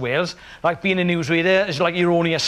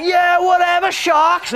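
high, louder careless yell; mock shout; 11 semitones higher, 4 dB louder, slower than usual. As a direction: shout it off dismissively. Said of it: "He's like".

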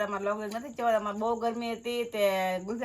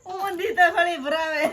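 laughing; 6 semitones higher, 6 dB louder, slower than usual. Real, not acted. Speaker laughs about the upside-down kulfi.

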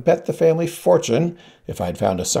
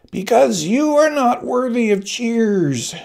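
put-on high, slow old-woman voice, bitter; a withered hag spitting scorn; crone's accusation; 12 semitones higher, slower than usual. Ancient woman to the queen, high and slow with scorn.

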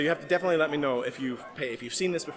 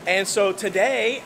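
normal talking voice

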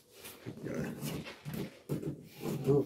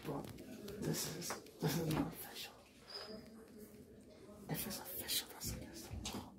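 whispering; 7 semitones higher, 17 dB quieter than usual. Real, not acted. Whispered aside during filming.

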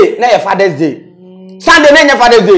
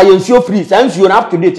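ordinary talk

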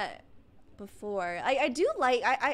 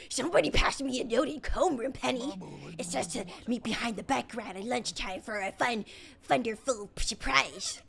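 put-on cartoon madman voice; Crazy Dave. Player reads out a note about homeroom, crazy character voice. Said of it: "Crazy Dave's voice".

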